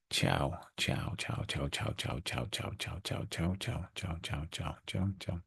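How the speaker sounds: a playful murmured farewell chant; sing-song ciao to departing coins; soft sing-song goodbye. Pretend. Waving off coins that dropped out.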